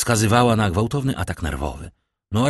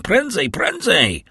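much higher, slow, desperate hurry-up cry; urgent shout; 11 semitones higher, 3 dB louder, slower than usual. A narrator's reading. Villefort urges the doctor to hurry, shouting. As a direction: drive them to hurry.

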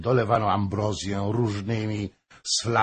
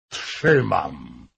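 low, forceful acceptance; teeth-grinding drunk anger; 4 semitones lower, 4 dB louder than usual. A narrator's reading. Pale, drunk Hoym insists on the bet, grinding it out. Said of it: "zgrzytnął zębami blady".

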